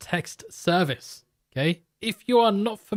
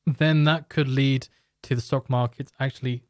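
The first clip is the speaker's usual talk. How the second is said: usual speaking voice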